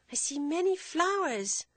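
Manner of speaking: put-on high, slow, sweet little girl; sweet small voice. A narrator's reading. Girl answers her father in a sweet small voice. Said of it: "she replied in a sweet, small voice".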